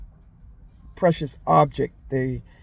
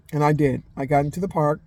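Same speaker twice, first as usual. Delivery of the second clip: ordinary delivery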